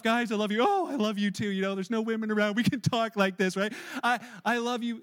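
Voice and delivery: loud, chummy mock affection; male bonding. Guys saying I love you with no women around, loud mock bonding. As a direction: play it as hearty guy talk. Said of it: "like, friendly bonding, male, like, male bonding stuff".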